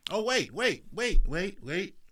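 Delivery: loud, high stop-everything; mock surprise. Speaker pretending to catch a flaw, mock loud surprise.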